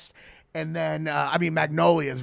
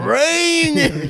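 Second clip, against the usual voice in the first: loud; 10 semitones higher, 6 dB louder than usual. Retold or imitated. Quoting the film's famous line.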